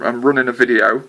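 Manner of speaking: ordinary delivery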